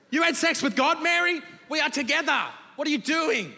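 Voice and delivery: high-pitched jealous disbelief; indignant outrage. Acted. Joseph learning Mary slept with God, high indignant protest.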